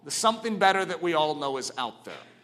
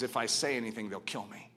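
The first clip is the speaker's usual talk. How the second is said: hushed fearful reply; 6 semitones lower, 9 dB quieter than usual. Retold or imitated. Richard answers wife in fear.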